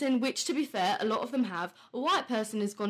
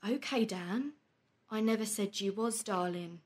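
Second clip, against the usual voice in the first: ordinary talk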